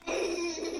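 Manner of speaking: high giggle. Real, not acted. Laughs at husband's teasing.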